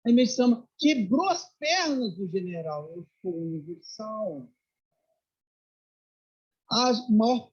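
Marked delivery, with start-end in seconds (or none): 2.9-4.5 very soft, slow quote; hushed, unhurried master's answer; serene declaration. Retold or imitated. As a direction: say it gently, almost to yourself. Master's answer that floors the general.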